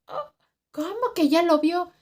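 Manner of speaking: high, loud disbelieving question; imitated shock. Cleaning lady learning she saw it, imitated shock. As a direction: gasp "oh", then exclaim in alarm. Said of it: "se quedó así de".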